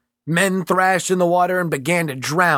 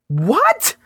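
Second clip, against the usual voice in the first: startled; 12 semitones higher than usual. Acted. Mitchell startled.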